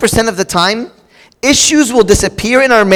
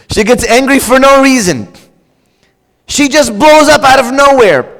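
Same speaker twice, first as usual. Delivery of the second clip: mock-frustrated, pitched up; hammed-up baffled husband; exasperated husband; 4 semitones higher than usual. Repeats husband quote, more acted.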